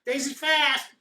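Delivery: high, proud little driver; chirpy boast about the reindeer; gnome driver voice. Acted.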